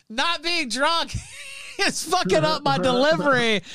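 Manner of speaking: words broken by high giggles; laughing through words. Retold or imitated. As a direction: laugh your way through the quote. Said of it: "I was like".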